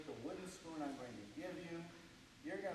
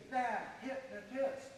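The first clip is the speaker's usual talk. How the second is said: call out; 7 semitones higher, 4 dB louder, slower than usual. Shown as a demonstration. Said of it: "get them to say".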